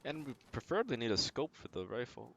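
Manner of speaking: usual speaking voice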